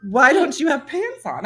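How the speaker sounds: emphatic retold question, laughter underneath; incredulous technician. Technician's question repeated, now emphatic.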